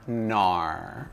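soft, drawn-out Australian "nar"; Aussie "no" said as "nar"; Australian accent. Shown as a demonstration. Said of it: "N A U R".

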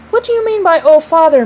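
high, loud, affronted question; indignant demand. A narrator's reading. Father caught cheating challenges his daughter, demanding indignantly. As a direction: demand an explanation, pitched right up. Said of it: "Mister Darling demanded".

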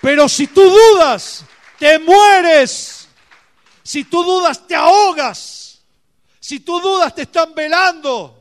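a pastor shouting warnings from the pulpit; shouted preaching. Real, not acted. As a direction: thunder it at the congregation. Preaching at full force.

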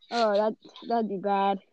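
ordinary talk, nothing marked

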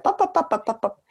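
mock brush-off. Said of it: "Jared would be like".

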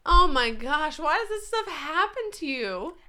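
high, loud disbelief; amazed outburst. Real, not acted. Co-host reacting to friend's story of crying stranger, amazed outburst. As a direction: exclaim in real amazement, pitch soaring.

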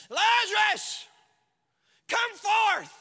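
loud, high shout; Jesus shouting into the tomb; calling out. Acted. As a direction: call him out of the grave.

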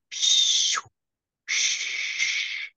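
voiceless "shh" bursts of static; imitated phone static. Storyteller mimicking the ghost app on friend's phone, static hiss. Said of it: "her phone goes".

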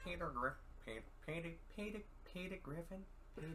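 a hint of Peter Griffin, fading as it fails; Peter Griffin impression. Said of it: "You sound more like Lois".

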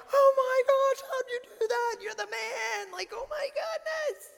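very high falsetto gushing; hammed-up starstruck squeal. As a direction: squeal like an overexcited fan, loud then fading. Acts out starstruck reaction to magician.

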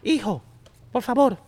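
high-pitched imitation of mom; put-on motherly appeal. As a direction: plead like a mother to her son. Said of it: "esa voz de mamá".

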